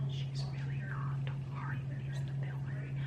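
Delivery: conspiratorial whisper to the camera; whispered aside. Real, not acted. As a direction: whisper it like a secret.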